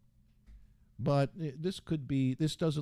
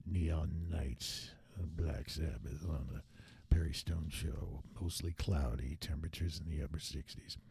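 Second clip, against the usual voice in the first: deep, low radio DJ reading the weather; low smooth rock station announcer; FM jock voice; 7 semitones lower, 6 dB quieter than usual. Shown as a demonstration. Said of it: "I was doing my old progressive F M jock voice".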